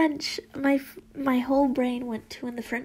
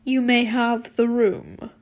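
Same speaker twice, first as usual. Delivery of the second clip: stern teacher; 4 dB louder, slower than usual. Acted. Stern headmistress dismisses herself.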